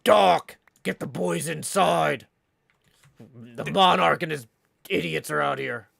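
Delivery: low, slow big-guy voice; deep booming cartoon hero; Patrick Warburton impression. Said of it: "let me see if I can do my best Patrick Warburton".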